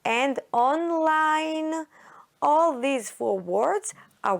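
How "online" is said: "online" slowly, drawing out the I on a high held pitch; online with long I. Shown as a demonstration. Teacher listing magic E words, "online" slow with a stretched I. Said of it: "puts pressure on the vowels to say".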